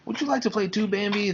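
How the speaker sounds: high, small bunny voices; sisters' high voices. Acted. Sisters invite Bambi to play, in high little voices. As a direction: pitch it up like little rabbits.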